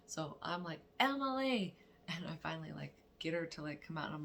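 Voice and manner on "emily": higher, louder call; shouting a name. Retold or imitated. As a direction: yell her name to break through her headphones. Calling again, louder.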